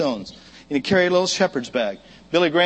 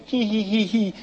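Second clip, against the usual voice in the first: taunting giggle; 4 semitones higher than usual. Acted. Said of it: "probably went".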